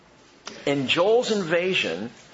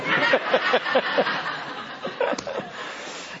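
high breathy laugh; 12 semitones higher than usual. Real, not acted. Laughs at his own joke.

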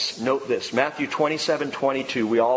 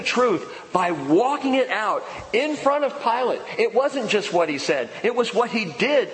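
fervent preaching; 5 semitones higher than usual. Real, not acted. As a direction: build it like a revival sermon. Preaching the good confession.

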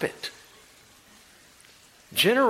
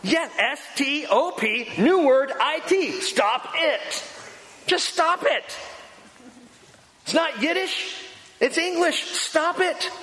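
high-pitched exasperated spelling-out; fed-up therapist yelling, very high; retold yell; 12 semitones higher, slower than usual. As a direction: holler it as if she is being dense. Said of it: "he just yells in her face".

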